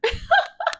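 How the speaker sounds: a sudden high burst of laughter. Real, not acted. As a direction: cackle at your own joke. Laughs at her own joke.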